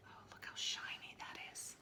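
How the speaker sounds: hushed aside about the picture; whisper. Real, not acted. Teacher whispering to the children about the shiny scale. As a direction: breathe it out in wonder.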